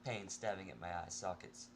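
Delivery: ordinary delivery, nothing marked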